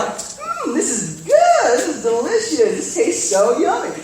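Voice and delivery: high, savoring mock praise of the candy; playing the parents humming happily over a treat; imitating the parents enjoying it. Pastor imitating parents savoring a tasty jelly bean, high and pleased.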